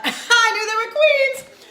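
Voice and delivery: high, loud burst through a laugh; laughing exclamation. Real, not acted. Host joking about kings and queens, laughing squeal. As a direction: squeal it out, amused at your own joke. Laughing repeat of own joke.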